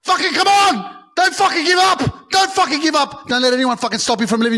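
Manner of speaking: high, all-out motivational yelling; hyped rallying shout. Real, not acted. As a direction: yell like a fired-up hype man. Hyped podcast opener rallying the listeners.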